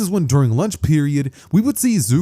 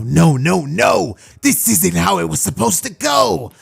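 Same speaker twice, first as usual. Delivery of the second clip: louder, agitated protest; worried villain; 4 semitones higher, 4 dB louder, slower than usual. Acted. Villain watching his monster lose, worried and frantic. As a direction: protest as the plan falls apart. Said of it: "worried Shigaraki scratching at his neck".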